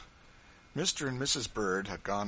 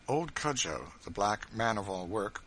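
plain reading voice

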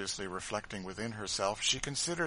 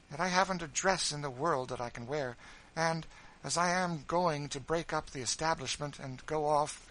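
higher, fussy lady's voice; a self-absorbed widow airing her plans; spoiled lady complaining of her arrangements; fretful mistress; 6 semitones higher, 4 dB louder than usual. Acted. Marie voiced by reader.

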